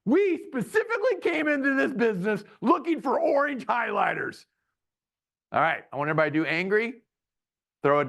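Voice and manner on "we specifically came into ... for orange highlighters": high, entitled complaining voice; Karen. Shown as a demonstration. Husband voicing his wife Karen complaining, high and entitled. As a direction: demand orange like an entitled customer. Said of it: "This is my wife, Karen".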